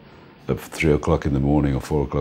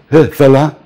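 high, loud happy hail; delighted greeting; 9 semitones higher, 8 dB louder than usual. Retold or imitated. Policeman recognising [name] at the roadblock, high delighted greeting. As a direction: greet him like a star. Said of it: "he goes".

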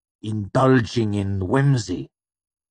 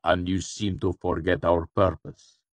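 cool voice with a growing edge; controlled reproach, turning sharper; cool, sharpening. A narrator's reading. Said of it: "his cool voice sharpening".

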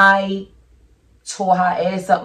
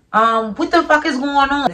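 shocked; 8 semitones higher than usual. Retold or imitated. Shocked at stabbing news.

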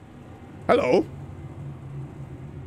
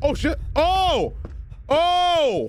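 high startled cries; scared horror game streamer; 7 semitones higher than usual. Real, not acted. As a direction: jolt of surprise at the crash. Startled by door busting.

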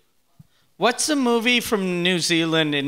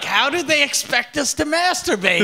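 higher, put-on voice of a frustrated Chuck; mock Chuck; 9 semitones higher than usual. Friend voicing Chuck's silly complaint, high put-on whine. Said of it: "And he's like".

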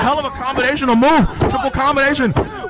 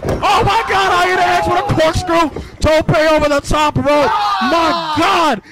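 excited shout; 6 semitones higher, slower than usual. Real, not acted. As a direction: scream it as the crowd erupts. Commentator erupts at big move.

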